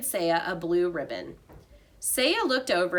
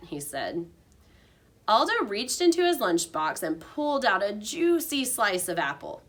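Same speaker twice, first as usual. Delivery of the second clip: plain reading voice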